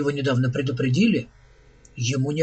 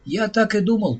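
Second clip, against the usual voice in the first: lightly voiced robot, pitched higher; a touch brisker and higher, as the robot; robot Andy, matter-of-fact; 6 semitones higher than usual. A narrator's reading. Robot to Richard Daniel, lightly voiced, higher.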